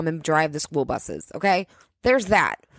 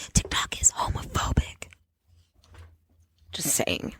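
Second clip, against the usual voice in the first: sly whisper, much softer; whispered aside; 6 semitones lower, 5 dB quieter than usual. Real, not acted. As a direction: let it slip conspiratorially.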